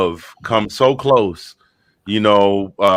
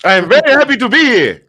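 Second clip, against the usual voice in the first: overjoyed; 12 semitones higher, 4 dB louder than usual. Acted. Guest greets hosts joyfully.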